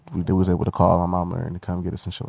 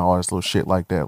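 normal talking voice

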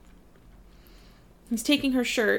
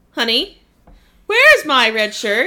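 calling to spouse; 9 dB louder, slower than usual. Retold or imitated. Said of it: "And then it'd be".

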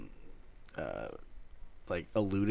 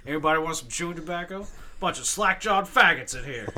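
higher, louder gruff movie soldier; tough soldier impression; 8 semitones higher, 5 dB louder than usual. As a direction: growl it like a cocky commando. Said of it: "And then I'll just be like".

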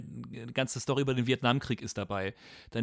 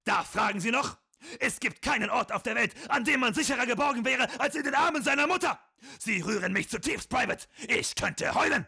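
very high, barked drill-sergeant orders; stiff barking toy soldier; 11 semitones higher than usual. Acted. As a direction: bark it like a tiny wind-up sergeant. Comic drill skit, sergeant moved. (hoher, zackiger Spielzeugsoldaten-Befehlston)

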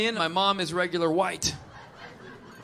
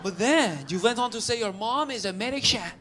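put-on approving, then objecting, Persian accent; 5 dB quieter than usual. Audience member critiquing the comic's act, Persian accent. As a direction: grant the point in a Persian accent. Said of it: "He came up".

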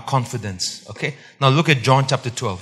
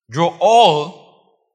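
"all" punched loud and raised; stress on ALL; 4 semitones higher, 7 dB louder than usual. Shown as a demonstration. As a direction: lean hard on "all". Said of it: "All what?".

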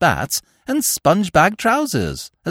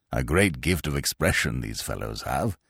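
low, amused and stuffy; pleased rumble of a pompous peer; chuckling old lord; 8 dB quieter than usual. Acted. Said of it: "he chuckled".

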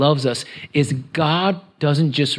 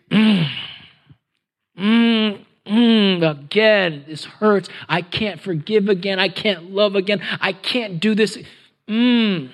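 high, straining groans into words; anguished groaning; 5 semitones higher, slower than usual. Acted. Believer who can't forgive again, acted anguished groaning. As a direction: groan from the belly, pleading in pain. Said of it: "just a groan".

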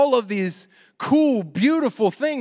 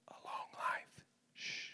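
breathy teasing whisper; whispered aside with a hush; 23 dB quieter than usual. Acted. Preacher to the congregation, a joking whisper with a shushing hush. Aside after Bible verse joke.

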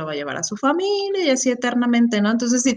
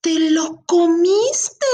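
put-on very slow, higher, wide-eyed toddler voice; innocent child's question; 7 semitones higher, 3 dB louder, much slower than usual. Toddler asking if the aunt ate the baby, slow and innocent. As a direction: ask it slowly with innocent toddler wonder. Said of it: "Y me dice".

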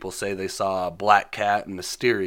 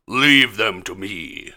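slow, louder menacing villain line; mock villain delivering his line slowly; 5 dB louder, slower than usual. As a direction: lean into a slow Doc Ock threat. Doc Ock voiced in a recap.

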